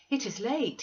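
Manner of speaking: put-on halting, breathless boy; speaking short with difficulty. A narrator's reading. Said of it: "speaking short and with difficulty".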